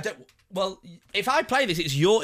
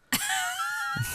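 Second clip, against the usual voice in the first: high shrieking laugh; 7 dB quieter than usual. Real, not acted. Laughs at own story.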